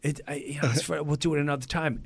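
soft, stammered excuse; hushed, awkward let-off; sheepish murmur. Retold or imitated. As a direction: back out of it quietly. Embarrassed backing away from snub.

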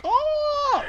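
high, drawn-out monster "oh"; monster moan. Retold or imitated. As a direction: mimic the banshee's wail. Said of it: "That bitch was like".

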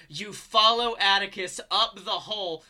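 ordinary delivery

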